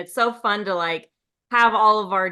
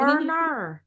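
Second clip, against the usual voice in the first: higher mock Australian twang; Australian accent; 4 semitones higher, 4 dB quieter than usual. Shown as a demonstration. Showing friends all she's got, exaggerated Australian accent. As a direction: repeat it in a put-on Australian accent. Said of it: "as your Australian accent".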